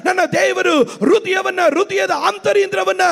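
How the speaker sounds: high, driving sermon shout; full-force preaching. Real, not acted. Pastor to weeping mothers at morning worship, full-force preaching. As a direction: preach with fervour, stretching the final cry.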